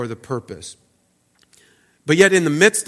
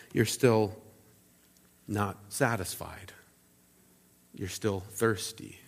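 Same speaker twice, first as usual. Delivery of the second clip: quiet; 6 semitones lower, 13 dB quieter, slower than usual. Retold or imitated. Jesus's quiet, pointed conclusion.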